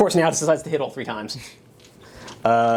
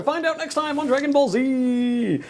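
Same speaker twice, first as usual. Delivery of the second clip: much higher, booming anime narrator voice; Saturday morning cartoon announcer; 11 semitones higher than usual. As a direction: tease the next episode like a cartoon announcer. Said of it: "Saturday morning cartoon announcer".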